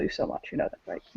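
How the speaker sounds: fading to a whisper; tender hushed tease; soft whisper. Acted. Will teasing the queen tenderly, soft to whispered. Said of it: "a very soft thing between the both".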